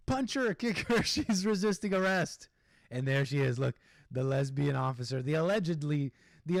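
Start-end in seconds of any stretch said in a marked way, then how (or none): 0.1-2.5 higher, hammed-up urging, breaking into laughter; mock police shouting. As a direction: jeer it out as a joke.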